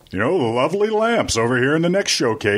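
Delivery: smooth announcer pitching lamps; radio voice. Pretend. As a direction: sell it like a game show host. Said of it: "you gotta just have your radio voice".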